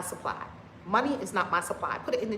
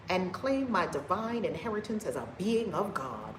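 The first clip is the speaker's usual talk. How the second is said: usual speaking voice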